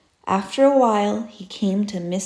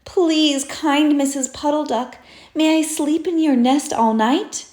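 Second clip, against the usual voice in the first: higher, politely begging; a small rabbit asking for a bed; pleading little rabbit; 6 semitones higher, 4 dB louder than usual. Acted. Rabbit begging Missus Puddle Duck for shelter, higher pleading voice. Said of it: "He said".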